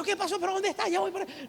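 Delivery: high, urgent retold panic; alarmed husband. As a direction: act out a worried husband rushing to help. Husband's alarmed reply on phone.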